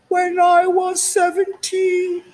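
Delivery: very high, slow, drawn-out; high drawn-out aside. Acted. Recalling being homeless at seventeen, high drawn-out voice. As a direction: stretch it out in a squeaky voice.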